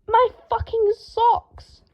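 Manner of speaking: slow, high, loud screech; very slow, high outraged cry. A narrator's reading. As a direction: screech over the ruined socks. Draco outraged at dusty socks.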